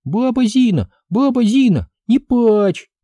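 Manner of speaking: put-on small girl pleading with grandma; child's call; little girl crying out. A narrator's reading. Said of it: "с криком".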